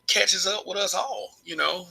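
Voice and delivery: ordinary talk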